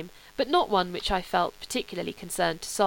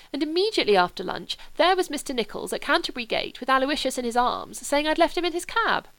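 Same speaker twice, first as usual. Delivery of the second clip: put-on higher, bubbling young aristocrat; eager young Sebastian; 5 semitones higher than usual. Sebastian tells Charles about Aloysius, eager and higher. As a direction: tell it like a delighted boy.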